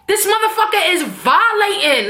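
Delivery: high-pitched New York indignation; outraged Tommy. Retold or imitated. Quoting Tommy's complaint to Ghost, outraged. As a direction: imitate a fed-up New Yorker's outburst. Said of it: "one of the most New York things Tommy has ever said".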